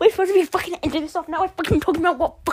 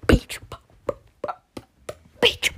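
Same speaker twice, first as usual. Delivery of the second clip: ordinary talk, nothing marked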